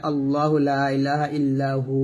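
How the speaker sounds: recited Ayatul Kursi line; Quran recitation. Shown as a demonstration. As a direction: intone it as in prayer. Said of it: "আয়াতুল কুরসিতে পাই".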